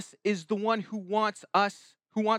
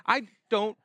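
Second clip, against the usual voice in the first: ordinary talk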